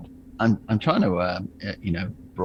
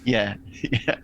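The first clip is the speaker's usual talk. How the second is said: normal talking voice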